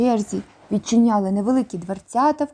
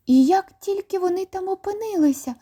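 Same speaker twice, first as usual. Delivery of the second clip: put-on girl voice; 9 semitones higher than usual. A narrator's reading. Jane asks Lion.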